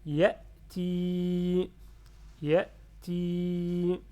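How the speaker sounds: YA'TI with short first syllable, long loud ti; prolonged final i. Shown as a demonstration. Teacher repeats word after explaining kasra, long steady i. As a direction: hold the final i of YA'TI on a level note. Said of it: "il est là pour prolonger le kasra".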